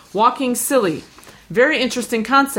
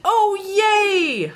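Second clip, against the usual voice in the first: excited; 12 semitones higher than usual. Real, not acted. Delighted reaction to song pick.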